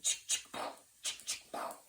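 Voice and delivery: breathy mouth-sound shotgun chick chick pow; imitated shotgun blasts. Telling friends about the night, mimicking shotgun blasts. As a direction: spit out the gunfire noises with no voice. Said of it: "And it sounds like".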